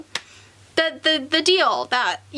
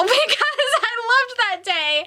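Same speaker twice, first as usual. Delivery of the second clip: real, on verge of tears; 8 semitones higher than usual. Real, not acted. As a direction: voice climbing into a tearful squeak. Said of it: "I could cry about the grand opening now".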